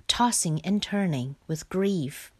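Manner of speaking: ordinary delivery, nothing marked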